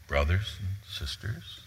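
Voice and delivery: soft, halting formal address with questioning rise; formal greeting. Shown as a demonstration. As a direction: say it formally and softly, ending upward. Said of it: "Just doesn't seem right to be formal here".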